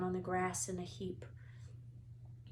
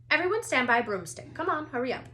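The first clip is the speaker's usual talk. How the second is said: loud, fast, higher commands; brisk orders; 5 semitones higher, 8 dB louder, faster than usual. A narrator's reading. Madame Hooch hurries the students, loud and fast. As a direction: hurry them along. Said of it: "she barked".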